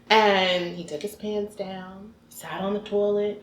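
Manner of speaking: ordinary delivery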